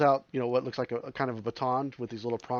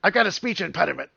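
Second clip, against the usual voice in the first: creature voice; 7 semitones higher, 13 dB louder than usual. Acted.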